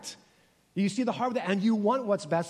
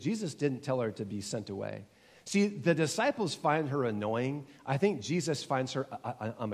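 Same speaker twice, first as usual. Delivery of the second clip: usual speaking voice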